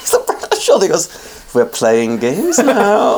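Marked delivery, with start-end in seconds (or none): 1.5-3.2 slow sly tease. Acted. Dracula with eye patch, teasing.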